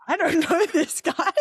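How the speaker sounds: high, laughing mock protest; amused bewilderment. Acts out bewildered reaction in photos.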